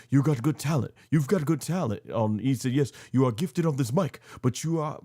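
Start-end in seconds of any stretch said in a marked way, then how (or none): 0.1-2.0 imitating Oba Femi's accent. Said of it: "I can't do the accent". 2.7-5.0 imitating Oba Femi's accent. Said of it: "I can't do the accent".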